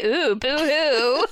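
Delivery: high, slow mock sobbing boo-hoo; fake crying. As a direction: whine it like a pretend crybaby. Mocking a parent's complaint.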